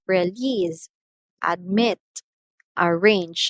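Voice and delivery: release, admit, arrange one at a time, weight on the second syllable; last-syllable stress. Shown as a demonstration. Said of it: "it's on the last syllable if it's two syllable word".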